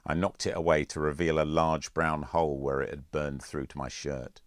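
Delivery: usual speaking voice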